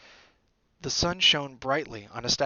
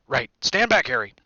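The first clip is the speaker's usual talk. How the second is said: Hagrid; 8 dB louder than usual. A narrator's reading. Hagrid about to open the wall, loud warning. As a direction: order him back firmly. Hagrid warns Harry back.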